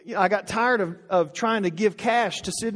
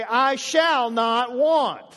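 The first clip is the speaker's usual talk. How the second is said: put-on drawn out to the kids; slow, emphatic "I shall not want"; 4 semitones higher, much slower than usual. Shown as a demonstration. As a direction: say it slowly to your kids. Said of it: "and say".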